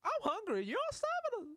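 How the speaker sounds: put-on very high child's voice, indignant; angry little kid. Reacting to a hungry child in the video, very high kid voice. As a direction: squeak it out like an outraged kid. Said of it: "She's like".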